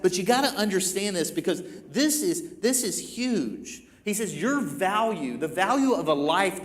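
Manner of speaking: high, loud, urgent preaching voice; fervent preaching. Acted. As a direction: preach Paul's message at full force to the congregation. Paul's words preached at full force.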